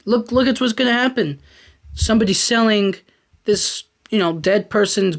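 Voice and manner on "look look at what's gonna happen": higher, eager ghost showing the future; lively ghost voice. Retold or imitated. Ghost showing Scrooge the future, mock excited guide voice. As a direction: point it all out like an excited guide. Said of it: "And he shows him".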